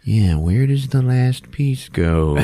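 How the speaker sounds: slow, pondering kid impression; thinking it over. Boy finishing his puzzle, slow mock pondering. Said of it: "thinking about it for a second".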